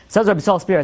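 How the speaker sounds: usual speaking voice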